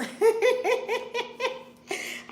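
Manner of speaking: long high-pitched giggle; real laughter. Real, not acted. Host joking about makeup left in the States, laughing. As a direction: let a high giggle run on.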